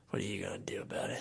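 put-on fast whispered drunk dare; mock tough whisper. Drunk teen whispering a dare at his brother. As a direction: whisper the challenge, cocky. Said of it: "And I was like".

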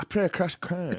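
high mock Chinese accent, R for L. Posing as a player of the game, mock Chinese accent. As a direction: say "play" and "Clans" with Rs, mock Chinese. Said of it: "how would the Chinese say that".

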